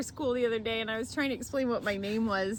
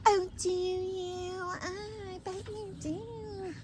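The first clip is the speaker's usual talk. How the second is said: character voice; 11 semitones higher, 4 dB quieter, much slower than usual. Pretend. Kristen Wiig Bridesmaids bit.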